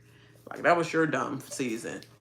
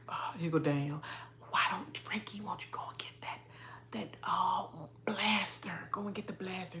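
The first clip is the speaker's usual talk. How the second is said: mock whisper; 4 semitones higher, 9 dB quieter than usual. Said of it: "she was like".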